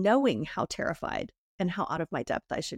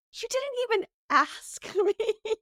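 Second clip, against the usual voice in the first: high, drawn-out protest breaking into laughter; mock indignation; 13 semitones higher, slower than usual. Friend signed her up unasked, laughing mock indignation.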